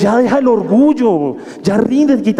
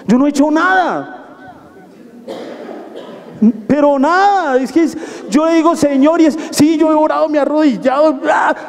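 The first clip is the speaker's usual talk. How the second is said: raised, pressing sermon voice with shouted peaks; preacher urging the church at full force; fiery preaching; 4 semitones higher than usual. Acted. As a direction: push the congregation to stop being sad.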